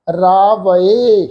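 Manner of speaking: ਰਾਵਏ said very slowly, "raa-va-e" with a separate final "e"; correct pronunciation. Shown as a demonstration. Teacher models the correct reading ਰਾਵਏ, slowly. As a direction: draw out ਰਾਵਏ as three clear syllables. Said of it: "ਰਾਵੈ ਨਹੀਂ ਪੜ੍ਹਨਾ".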